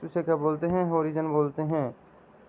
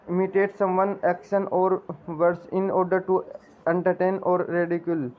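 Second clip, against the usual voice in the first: slow, even reading of the definition for students to write down; slow dictation; slower than usual. Shown as a demonstration. Teacher dictating a definition to students, slow and measured. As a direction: dictate each word clearly and slowly. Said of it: "दोबारा से पढ़ लीजिएगा।".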